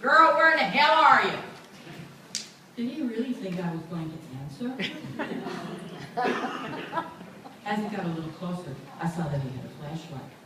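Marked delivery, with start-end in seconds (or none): 0.0-1.4 loud, high calling out; yelling. Retold or imitated. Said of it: "far in the distance yelling". 4.8-7.6 quiet amused chuckle; a little laugh to herself; soft laugh. Real, not acted. Narrator laughs at memory.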